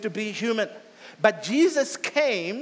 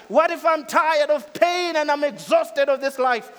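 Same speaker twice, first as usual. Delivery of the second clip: raised, anguished plea of someone worn out; weary outcry; 7 semitones higher, 7 dB louder than usual. Acted. Sufferer pleading to God, raised anguished voice. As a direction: cry out from exhaustion and pain. Exhausted questioner crying out.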